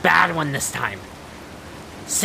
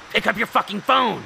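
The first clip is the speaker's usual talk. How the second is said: exasperated, higher, words bitten off; frustrated snap; 5 semitones higher than usual. Acted. Terezi fed up that John won't answer, snapping. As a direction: lose patience with the ringing phone. Frustrated, call still unanswered.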